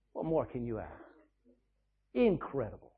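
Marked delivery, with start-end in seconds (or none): 0.1-0.9 softer repeat. Shown as a demonstration. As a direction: drop to a hushed, much softer echo.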